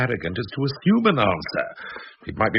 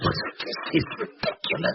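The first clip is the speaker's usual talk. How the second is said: muttered aside; 6 dB quieter, much slower than usual. Acted. Muttered aside in tense quarrel.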